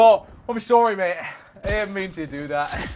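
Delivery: put-on a loud, hammy stab at an Australian accent. Caster apologizing to chat in an accidental Australian accent. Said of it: "I went to Australian".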